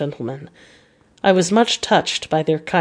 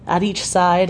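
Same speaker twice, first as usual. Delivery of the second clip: plain reading voice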